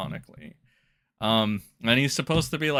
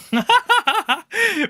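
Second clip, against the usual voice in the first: high burst of laughter; 10 dB louder than usual. Real, not acted. Laughing at the tier list.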